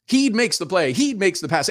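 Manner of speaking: imitating excited play-by-play; enthusiastic call. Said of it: "calling out the names in the enthusiastic call".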